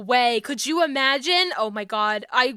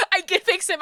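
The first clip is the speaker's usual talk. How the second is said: excited declaration; 6 semitones higher than usual. Real, not acted. Joking about fixing Robert.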